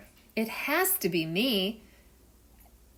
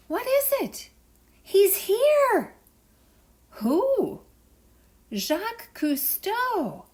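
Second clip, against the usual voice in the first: high, loud, thrilled gasps; can't believe who's here; excited; 14 semitones higher, 10 dB louder, much slower than usual. Acted. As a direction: sea creatures spotting Jacques Cousteau arrive. Sea animals spot a visitor.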